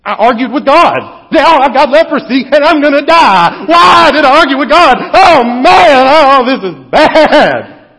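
mock panicked Moses, building from worry to dread. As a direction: fret like a man who just got leprosy. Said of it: "he's kind of probably thinking".

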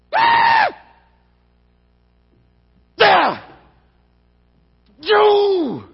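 high, loud wordless roar, then a drawn-out cry; exploding in hot anger; furious frustrated scream. Acted. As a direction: scream with rage too big for words.

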